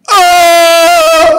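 loud high anguished cry; despairing scream. Acted. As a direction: let out a desperate yell.

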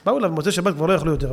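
ordinary talk

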